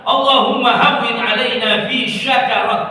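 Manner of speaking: "syakarat" with sh, as the error; wrong shin sound. Shown as a demonstration. Teacher repeating the wrong reading for the class. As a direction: read "syakarat" the mistaken way with sh. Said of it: "Nah, ini keliru".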